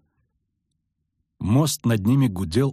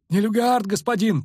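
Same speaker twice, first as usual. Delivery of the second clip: high-pitched cry of alarm; shout; 12 semitones higher than usual. A narrator's reading. Soldier warns his lord of Nilfgaard, crying out. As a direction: cry the warning in panic. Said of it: "крикнул кнехт".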